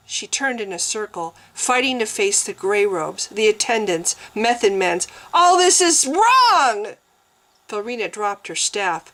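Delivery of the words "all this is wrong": high, loud, slow outcry; desperate cry. A narrator's reading. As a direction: cry out against it all. Desperate outburst before the altar.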